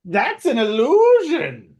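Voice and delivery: high, loud, slow kobold exclaiming; sharp kobold accusation; kobold realizing. Acted. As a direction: shout the discovery as a squeaky kobold. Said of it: "and it goes".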